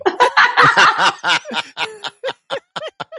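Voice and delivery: very high, loud shriek of laughter. Real, not acted. Cohost hearing the church story, shrieking with laughter. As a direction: burst out screaming with laughter.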